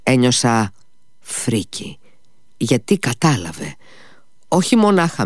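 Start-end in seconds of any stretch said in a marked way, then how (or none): 1.2-2.0 whispered word of dread; a whispered shudder; hushed horror. A narrator's reading. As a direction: breathe it out in horror. Narrator recalls feeling horror.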